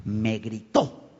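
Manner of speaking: low, soft, slow conclusion; acted inner verdict. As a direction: let the private grievance settle slowly and quietly. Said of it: "la última verdad declarada".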